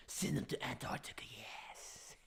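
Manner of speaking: gleeful evil whisper; hushed, scheming mock malice; maniacally evil. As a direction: whisper it like a plotting villain. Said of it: "maniacally evil".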